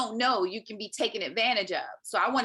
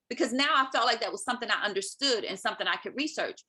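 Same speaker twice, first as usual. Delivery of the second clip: normal talking voice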